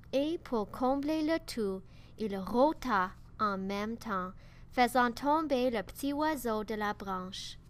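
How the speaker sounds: plain reading voice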